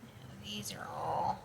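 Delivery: whispering. Real, not acted. Murmuring while browsing.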